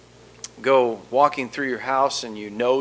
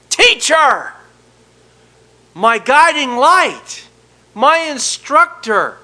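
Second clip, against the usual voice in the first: high, loud, slow cry of joy; joyful cry; 9 semitones higher, 8 dB louder, slower than usual. Retold or imitated. Mary recognizing her teacher, crying out in joy.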